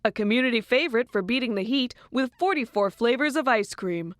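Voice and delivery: bright TV news read; news anchor. Acted. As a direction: deliver it like a cheery anchor.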